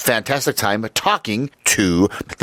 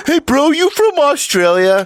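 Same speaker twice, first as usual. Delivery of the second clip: much higher, louder dumb bro voice; clueless American bro; 4 dB louder than usual. Retold or imitated. Host mocking himself asking the British guest if he's Australian, hammed-up bro voice. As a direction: play the oblivious American yelling a question. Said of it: "I thought he sounded Australian".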